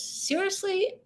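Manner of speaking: high, rising disbelief; an outraged high Seriously; incredulous. Retold or imitated. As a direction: act stunned at the refusal. Kids refuse to fetch her.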